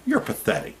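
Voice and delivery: pitched high, openly scornful; contemptuous jeer at a pro-nuclear politician; scornful put-down. Shown as a demonstration. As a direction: call the politician pathetic to his face. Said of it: "we need to start talking like that to politicians".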